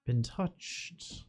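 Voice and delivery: slow, hushed scene setting; slow dramatic narration. Acted. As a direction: paint the scene slowly, with awe. GM describes the ancient church.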